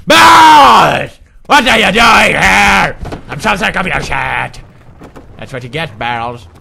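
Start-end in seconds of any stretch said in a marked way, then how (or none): 0.1-4.6 high loud startled shout. Acted. Gamer startled by barrels, loud shouting.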